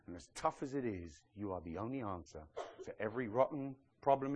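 Peter's confession, dropping to a soft, quiet murmur; voicing Peter. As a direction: speak it as a humble, hushed plea to Jesus. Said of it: "Peter again".

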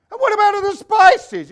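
shouted, very high mock son; questioning Italian son. Son quizzing Papa about spices, high loud mock voice. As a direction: demand it at a high-pitched yell. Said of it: "this Italian guy goes home".

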